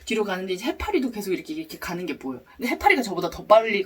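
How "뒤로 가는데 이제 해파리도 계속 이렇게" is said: usual speaking voice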